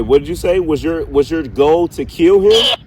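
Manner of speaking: usual speaking voice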